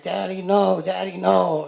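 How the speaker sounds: put-on a small child begging his dad not to go; little kid pleading. Child crying in mother's arms.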